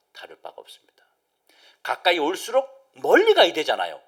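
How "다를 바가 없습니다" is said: hushed unvoiced whisper; sermon whisper. Real, not acted. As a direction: whisper it gravely. Preacher drops to whisper.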